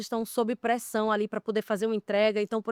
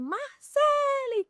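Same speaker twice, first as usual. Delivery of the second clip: much higher, drawn-out call of the name; stressed coworker calling; much slower than usual. Retold or imitated. Stressed crew member calling her name over the radio, high and stretched. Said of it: "a pessoa vem assim".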